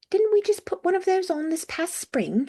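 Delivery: high-pitched British man's query; puzzled British character voice, pitched well up; British accent. A narrator's reading. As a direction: ask it as a lightly caricatured Brit. British colleague character asks in dialogue.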